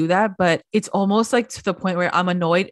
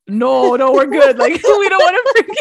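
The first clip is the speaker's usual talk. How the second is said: high, loud group backing out; mock panicked refusal; 6 semitones higher, 7 dB louder than usual. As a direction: voice the group hastily begging off, pitch climbing into laughter. Everyone acted, refusing to get involved.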